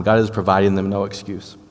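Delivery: normal talking voice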